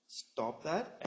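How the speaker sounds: ordinary talk, nothing marked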